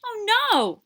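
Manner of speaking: high alarmed cry; frightened cry. Acted. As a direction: yelp it in sudden fear. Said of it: "cried the second hare".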